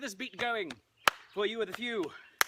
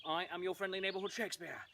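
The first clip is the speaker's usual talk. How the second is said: usual speaking voice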